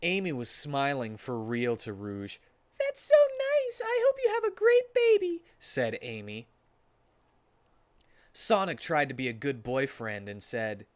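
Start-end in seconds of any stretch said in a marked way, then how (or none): 2.8-5.4 sweet high Amy voice. Acted.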